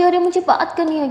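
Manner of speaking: put-on a little girl complaining she wants to talk; child's protest. Child protests phone taken away.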